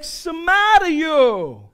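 loud, high, drawn-out Italian indignation; mock Italian accent. Preacher imagining an Italian Paul, mock Italian outrage. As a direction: scold like a hammed-up Italian. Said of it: "If Paul was Italian, he'd be like".

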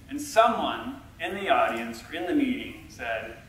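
ordinary talk

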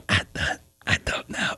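sheepish voiceless mock whisper; hushed acted admission; retold whisper. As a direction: whisper it like a guilty kid. Retold whispered confession to cousin.

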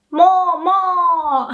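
high, loud mooing "Mo, mo"; cow moo. Shown as a demonstration. Teacher imitates a cow for students. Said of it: "That's the sound cows make".

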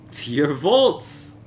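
high, loud, slow demand; insistent Fortunato. Acted. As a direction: drawl it out, tipsy and determined.

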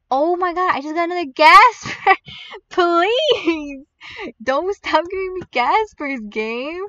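loud, high wail of frustration; pleading, exasperated yell; exasperated outburst. Real, not acted. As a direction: cry out at rotten luck. Yet another unwanted Gasper drawn.

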